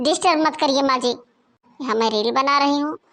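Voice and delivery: ordinary talk, nothing marked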